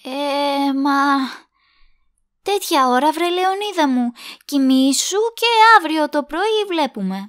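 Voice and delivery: loud, fed-up late-night brush-off; exasperated. Acted. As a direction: push him off to bed, loudly exasperated. Loud late-night refusal to partner. (δυνατά, εκνευρισμένα)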